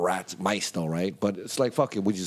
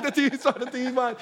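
relieved laughing; 8 semitones higher, faster than usual. Retold or imitated. Relieved TV survived.

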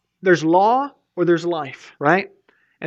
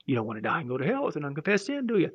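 ominous mock warning; 8 dB quieter, much faster than usual. Holy Spirit threatening quietly.

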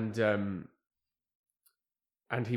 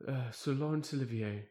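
theatrical luvvie producer; 3 semitones higher, 4 dB louder than usual. Retold or imitated. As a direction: ham up the old theatre darling. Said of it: "I'm gonna give him this voice".

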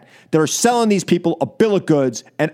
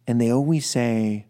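ordinary talk

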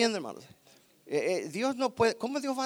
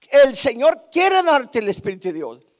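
louder, driving preacher's call; forceful preaching; 5 semitones higher, 10 dB louder than usual. Acted. Preacher exhorting the congregation, loud and forceful. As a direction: press the point home to the church.

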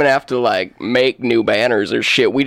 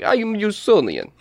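idiot voice; 6 semitones higher than usual. Acted. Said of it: "sounds like you should be like".

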